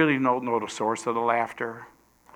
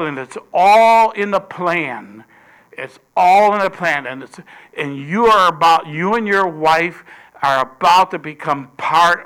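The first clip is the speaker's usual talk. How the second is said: louder, triumphant announcement; bold proclamation; 3 semitones higher, 8 dB louder than usual. Acted. Angel announcing the promised son to Zacharias, loud and bold. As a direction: proclaim it like a heavenly messenger.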